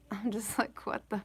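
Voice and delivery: hushed, breathy disbelief; stunned whisper. Retold or imitated. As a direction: whisper it in shock. Stunned reaction, laughing whisper.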